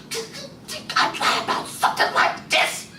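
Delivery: high breathy plea. Acted. Imogene's voice in the story.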